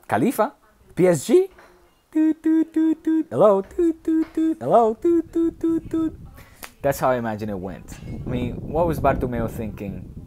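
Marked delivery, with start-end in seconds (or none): 0.1-6.1 very high, loud taunting toot toot; a cartoon rival gloating with a train-horn tease; hammed-up PSG owner honking hello in triumph; mocking PSG owner. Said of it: "that little scene".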